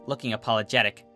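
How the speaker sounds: plain reading voice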